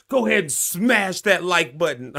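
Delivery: mock influencer pitch; millennial podcaster. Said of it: "gonna say it like a millennial podcaster".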